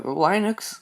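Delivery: Linux said "LYE-nux", rising tone; Linux pronunciation variant. Shown as a demonstration. Reviewer offering viewers ways to say the OS name, Linux as "LYE-nux". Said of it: "depending on how you wanna say it".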